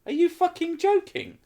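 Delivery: high, loud disbelieving cry; incredulous outburst. Retold or imitated. Reacting to the neighbour admitting it was her. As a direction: explode in disbelief. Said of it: "I'm like".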